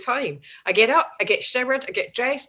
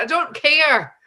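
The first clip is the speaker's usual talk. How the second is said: loud, high, laughing retort; mock shouty reply; 4 semitones higher, 6 dB louder than usual. [name] shouting back at a stranger, loud and laughing.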